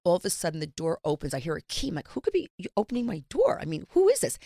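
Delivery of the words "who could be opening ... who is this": retold alarm, rising high at the end; who on earth is that?, voice climbing; startled disbelief. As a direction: act out being startled by an intruder. Startled reaction to door opening.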